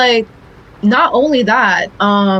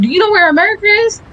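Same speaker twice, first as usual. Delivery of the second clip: teacherly question; 10 semitones higher, much faster than usual. Retold or imitated. Teacher voice to kids.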